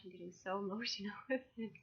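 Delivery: quick, laughing through the words; real emotion breaking into a laugh; emotional laugh. Real, not acted. Launching her first deck, laughs through rising emotion. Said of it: "And oh my gosh".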